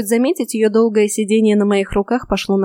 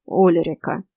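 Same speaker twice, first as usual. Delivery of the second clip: low-key reproach in a drawn-out name; mildly reproving; reproachful; 3 semitones lower, slower than usual. A narrator's reading. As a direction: chide her with just her name. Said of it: "укоризненно произнёс Седрик".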